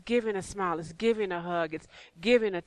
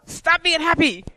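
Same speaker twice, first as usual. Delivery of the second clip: mock exasperated order; 9 semitones higher, 8 dB louder than usual. Telling the always-happy pastor off, loud mock exasperation. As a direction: scold someone for their cheerfulness.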